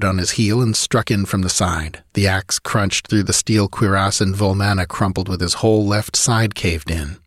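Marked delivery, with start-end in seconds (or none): none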